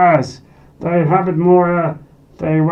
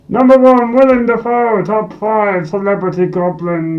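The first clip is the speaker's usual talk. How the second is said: loud, high, booming announcement of number one; loud, high announcer call; excited announcement; 7 semitones higher, 6 dB louder than usual. Acted. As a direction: proclaim the champion. Number one reveal repeated.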